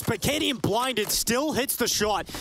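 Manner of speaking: high, drawn-out live commentary; excited play-by-play. Real, not acted. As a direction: call the shot as it lands, stretching the words high.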